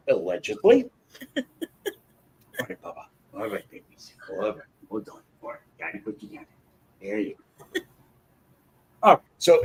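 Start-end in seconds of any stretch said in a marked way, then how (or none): 0.1-0.9 allegedly sung out higher with a coy rising tune; mock innocent hedge. Shown as a demonstration. 1.4-7.8 cooing to pets. Real, not acted. Talking to pets he picks up.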